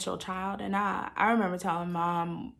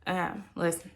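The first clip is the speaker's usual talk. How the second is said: ordinary delivery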